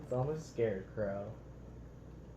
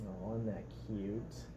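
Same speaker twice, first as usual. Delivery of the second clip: usual speaking voice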